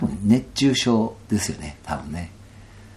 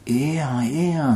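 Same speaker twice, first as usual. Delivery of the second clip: lightly voiced stars, a touch higher; stars talking; 6 semitones higher than usual. Stars reassuring him in Kansai dialect, mild voicing. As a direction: give the stars a gentle Kansai voice. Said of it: "お星さんが言うわけですよ".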